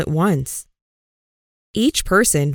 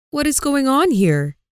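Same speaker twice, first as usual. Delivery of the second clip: a sage's raised, higher demand; 6 semitones higher than usual. A narrator's reading. Sage demanding to know what the fuss is, higher voice. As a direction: wise man breaking up a fight, pitched higher. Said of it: "the sage demanded".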